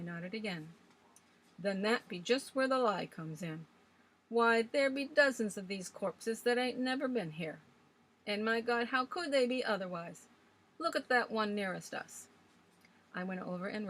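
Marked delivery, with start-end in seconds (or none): 1.6-12.3 put-on old Swales ranting. A narrator's reading. Old man scoffing at legends to the young ladies, loud and gruff. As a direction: rail against ghost stories like a stubborn old sailor.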